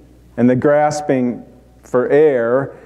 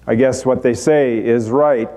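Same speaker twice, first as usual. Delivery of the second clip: normal talking voice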